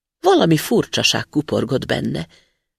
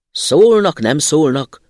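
loud, dismissive booming; thundering spirit; 7 dB louder, slower than usual. A narrator's reading. Spirit brushes off the student's question: loud thundering. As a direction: thunder it off impatiently. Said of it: "dörgött a szellem".

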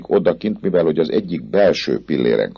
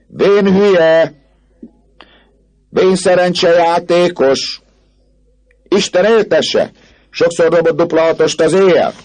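very high, loud insults; jeering; 14 semitones higher, 5 dB louder than usual. A narrator's reading. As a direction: yell abuse down at the old man. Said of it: "csúfondáros megjegyzésekkel és gúnyos".

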